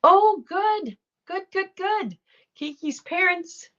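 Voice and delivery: thrilled that a viewer's parents got vaccinated; delighted. Real, not acted. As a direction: gush over the good news. Delighted reading of good news.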